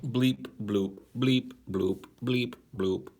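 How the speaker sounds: even machine gun syllables; robot voice. Pretend. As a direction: bleep bloop robot voice, evenly chopped. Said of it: "I am a robot".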